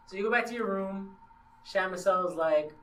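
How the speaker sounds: usual speaking voice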